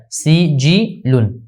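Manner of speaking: سجلن drawn out very slowly, syllable by syllable; slow demo. Shown as a demonstration. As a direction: spell out سجلن slowly for the students. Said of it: "زیر ہے".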